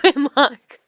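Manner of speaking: high burst of giggling; real laugh breaking through the words; real laughter. Real, not acted. Laughing at the memory.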